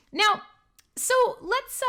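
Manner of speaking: usual speaking voice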